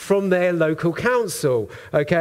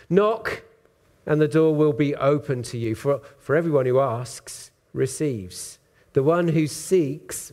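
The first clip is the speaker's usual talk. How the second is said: scripture reading; 2 semitones lower than usual. Acted. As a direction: read the verses aloud, weighing each phrase.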